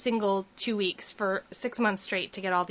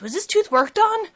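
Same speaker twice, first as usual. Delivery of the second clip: higher, louder puzzled question; mock puzzled dentist; 10 semitones higher, 8 dB louder than usual. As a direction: ask it baffled and loud. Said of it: "being like".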